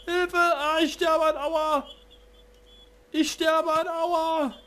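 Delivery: high, slow, hammed-up dying wail; overacted death scene. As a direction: wail like a cartoon dying hero.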